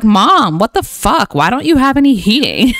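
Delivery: hammed-up toddler protest; indignant child. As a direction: complain as an indignant kid to mom. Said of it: "He's like".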